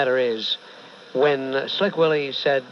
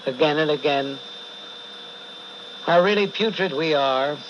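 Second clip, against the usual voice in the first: preaching. Acted. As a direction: preach it with weight. Host preaches about nature.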